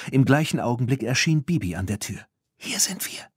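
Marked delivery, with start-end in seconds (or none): 2.6-3.3 higher, hushed unvoiced call; stifled call. Bibi waving Claire over, calling in a hushed whisper. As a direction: call out but keep it a whisper. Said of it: "rief sie mit unterdrückter Stimme".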